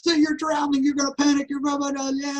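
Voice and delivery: high, rapid-fire mock frenzy; frenzied inner voice. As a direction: try to whip him into panic. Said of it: "doing this frenzy thing".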